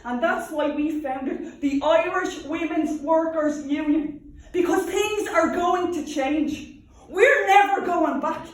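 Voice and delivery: slow, loud rallying cry building to a high shout; rousing defiance. Acted. Union woman vows things will change, defiant and rising to a shout. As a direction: build from firm proclamation to full-voiced shout. Rousing climax of activist's monologue.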